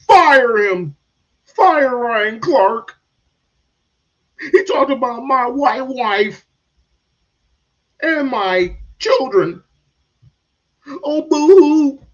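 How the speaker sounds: high, loud, slow whiny outcry. Retold or imitated. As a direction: ham up the outrage.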